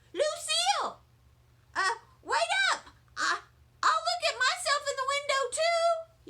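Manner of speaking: high, loud and fast calling; shouting. A narrator's reading. Sheldon shouting after Lucille to wait, high loud shout. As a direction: shout after her as she leaves. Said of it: "he began to shout".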